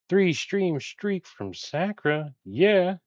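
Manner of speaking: high, drawn-out celebratory shout-out; excited cheer. Real, not acted. Cheering a viewer's stream streak.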